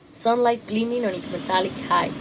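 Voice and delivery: plain reading voice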